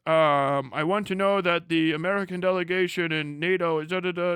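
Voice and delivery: hint of a self-important diplomat; mock delegate. Delegate addressing the other guys at the UN, mock speechifying.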